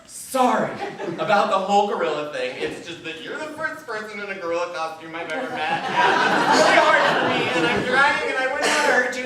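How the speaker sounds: loud, high, over-the-top apology; hammed-up panicked sorry; gushing apology. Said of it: "And now you're like".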